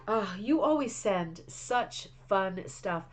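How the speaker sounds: ordinary talk, nothing marked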